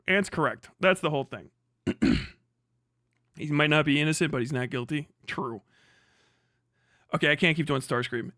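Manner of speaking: usual speaking voice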